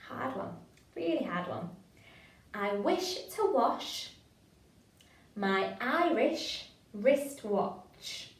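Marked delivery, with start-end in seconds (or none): 2.5-8.3 very slow, each word of the tongue twister spaced out; nice and slow. Shown as a demonstration. Drama leader slows the twister right down for the class. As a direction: take the twister at half speed. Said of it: "Nice and slow".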